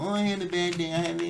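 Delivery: mocking a boyfriend who always complains; mock whiny boyfriend. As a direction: whine about a bad day to mock him. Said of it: "your man always coming home being emotional".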